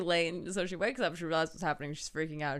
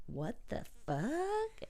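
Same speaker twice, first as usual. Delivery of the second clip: mock shock; 9 semitones higher, 7 dB quieter, much slower than usual. [name] reacting to message.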